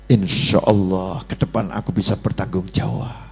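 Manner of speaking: low, soft, humble answer; reply meekly and calmly; husband answering his wife softly and humbly; gentle reply. Acted.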